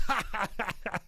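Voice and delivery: imitating a big laugh; mock laugh. Jim Ross laughing at his joke, imitated lightly. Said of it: "He goes".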